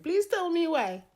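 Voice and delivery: high-pitched pleading wail; mock-despairing, high plea; exasperated plea. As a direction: beg for an explanation in a high voice. Exasperated plea while telling story.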